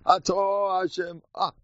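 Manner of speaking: a lightly voiced old rabbi's fervent blessing; imitating fervent prayer. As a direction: slowly intone it like a devout elder at prayer. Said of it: "the way he davened Shemoneh Esrei".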